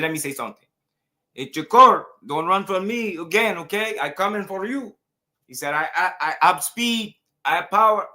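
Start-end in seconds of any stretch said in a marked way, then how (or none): 0.0-5.0 high, hammed-up Cuban accent call-out; clowning Cuban accent, pitched up; mocking Cuban accent. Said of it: "Y'all know I just be clowning with the accents". 5.7-8.1 high, loud mock Cuban accent; comic copycat Cuban accent, pitched way up. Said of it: "Y'all know I just be clowning with the accents".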